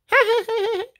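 high, far-off women's cackle; distant cackle. Acted. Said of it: "Off in the distance".

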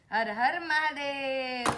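put-on very slow hushed prompt to a toddler; slow and soft. Shown as a demonstration. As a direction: draw each word out gently. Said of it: "હર હર Mahadev કરો".

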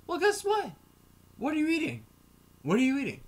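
high, pointed mocking question; mocking confrontation. Confronting a man who dislikes Mexicans, high mocking taunt. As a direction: needle him with a high taunt.

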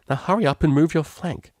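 annoyed. Acted. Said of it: "a little annoyed".

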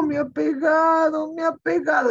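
put-on slow whining child complaint. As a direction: wail like a hurt little boy. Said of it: "decía así".

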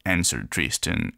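ordinary delivery

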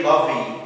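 lovey as "LUV-ee", two syllables; love misread with its E voiced; final E pronounced. Shown as a demonstration. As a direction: sound a wrong ee after the v in lovey. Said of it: "We don't say".

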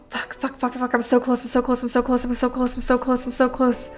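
tense hushed. Real, not acted. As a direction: hold your breath and murmur it. Tense near the end of round.

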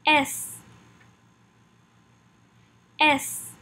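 French letter "S", said simply as "ess"; "S" modelled for learners to repeat; French pronunciation drill. Shown as a demonstration. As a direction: say "S" as an isolated letter name. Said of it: "it is also simply pronounced as S".

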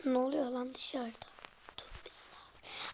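hushed whisper to himself; wary whisper at a noise outside. Real, not acted.

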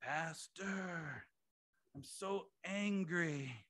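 lightly voiced complaining young man, slow; a hint of the caller whining to his pastor again; imitating angry young man. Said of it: "Very next day".

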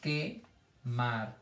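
"Quemar" as que, pause, mar; syllable by syllable. Shown as a demonstration. Teacher counting syllables for children, "Quemar" split in two. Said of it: "No hay sílaba del medio".

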